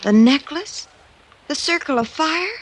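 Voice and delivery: can't believe she's being handed it; stunned, rising questions; astonished. Acted. Given the necklace unexpectedly.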